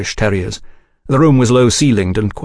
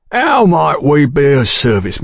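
slow, obliging proprietor's voice; eager to please the policeman; fawning proprietor; 5 semitones higher than usual. A narrator's reading. Sayers fawning.

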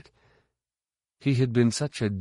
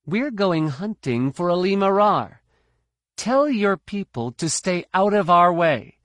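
higher, commanding Jedi woman; young woman's voice; 10 semitones higher, 5 dB louder than usual. A narrator's reading. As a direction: order them out of the way. Jaina commands.

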